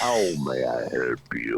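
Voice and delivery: genie. Pretend. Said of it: "You sound like a, a genie, a djinn".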